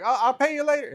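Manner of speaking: high, loud, hurried excuse while backing off; panicky debtor. Retold or imitated. Jimmy dodging the kid he owes, high loud panicky excuse. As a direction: blurt the excuse and bolt.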